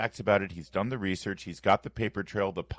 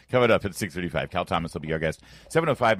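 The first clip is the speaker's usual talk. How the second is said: ordinary talk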